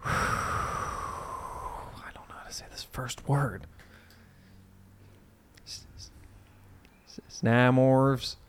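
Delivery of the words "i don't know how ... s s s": unvoiced whisper to himself. Real, not acted. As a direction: breathe it, no voice. Whispering over a cold read.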